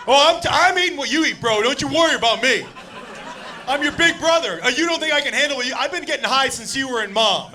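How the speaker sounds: mock-macho big brother boast; cocky big brother. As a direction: brag like the tough older brother. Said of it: "He was like".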